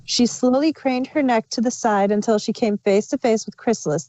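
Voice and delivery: ordinary delivery, nothing marked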